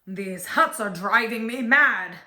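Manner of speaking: loud, exasperated king; ringing royal outburst. A narrator's reading. The fed-up king bellows across the palace, loud. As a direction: let the king's fury ring through the palace. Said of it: "The king's voice rang out".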